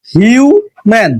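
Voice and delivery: হিউ and ম্যান said as two separate syllables; teacher breaking the word into হিউ, ম্যান; split into syllables. Shown as a demonstration. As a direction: pause between হিউ and ম্যান, with the U sounded as "yu". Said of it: "সেটাকে যদি ভাগ করি".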